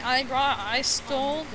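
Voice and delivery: ordinary talk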